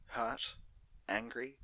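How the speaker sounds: plain reading voice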